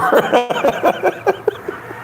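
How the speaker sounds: long wheezing high laugh; real laugh. Real, not acted. Host cracks up at the fighter's name, wheezy laughter. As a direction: laugh till the voice gives out.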